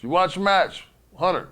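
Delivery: high, loud mocking echo; mock yell. Shane mocking Yoko's question to Hunter, loud. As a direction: throw the question back loudly. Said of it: "Shane just looks at me and goes".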